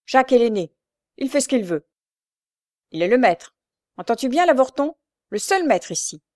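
indignant yapping retort; animated yelping. A narrator's reading. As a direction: yelp it back heatedly, pitch lifted. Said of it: "glapit tout aussitôt".

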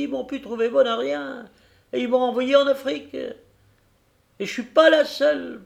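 put-on high, cracked old coach voice; old fairy voice. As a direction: speak as a creaky old stagecoach. Old diligence speaks as character.

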